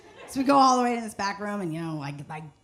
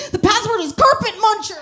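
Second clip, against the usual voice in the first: much higher and louder, blurt it out; blurted shout; 10 semitones higher, 10 dB louder than usual. Retold or imitated. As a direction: yell the punchline proudly. Blurting the password loudly.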